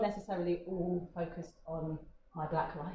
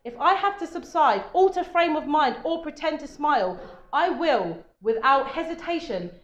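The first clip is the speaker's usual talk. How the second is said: loud, emphatic spoken-word threat, higher pitched; very angry; 9 semitones higher, 11 dB louder than usual. Acted. Poet performs Unnatural Woman to audience, very angry. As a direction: declaim it as a furious warning, stretching key words. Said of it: "makes me sound like a very angry".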